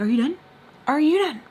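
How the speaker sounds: retold angry challenge, building on the repeat. Girlfriend tired of being his punch bag, angry demand. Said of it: "getting even more loud, getting more angry".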